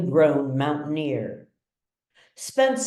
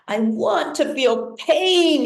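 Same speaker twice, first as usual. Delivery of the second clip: high, louder cry of pain; anguished scream; 10 semitones higher, 4 dB louder than usual. A narrator's reading. Paralysed son screaming to feel anything, high and loud. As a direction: scream it out in despair. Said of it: "he screams".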